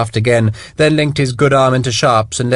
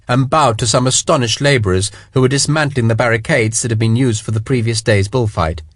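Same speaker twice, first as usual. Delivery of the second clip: ordinary delivery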